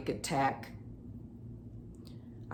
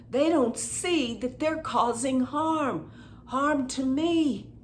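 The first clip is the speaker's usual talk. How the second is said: angry venting; 5 semitones higher than usual. Acted. Tapping on the psychic attack, venting angrily to the viewer. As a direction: rant at whoever attacked you. Vents anger while tapping.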